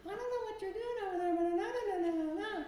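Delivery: high falsetto cartoon voice; exaggerated cartoon intonation. Shown as a demonstration. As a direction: talk like a cartoon character in falsetto. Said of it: "just talk like a cartoon character".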